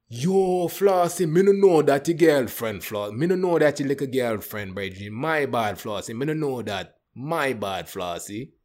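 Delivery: hammed-up Jamaican patois; Jamaican accent. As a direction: imitate a Jamaican guy apologizing, bursting in loud then easing off. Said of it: "he Jamaican cat".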